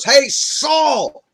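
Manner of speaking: high, loud call across distance. Acted. David calling after the king, loud call. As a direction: shout after him to turn him round.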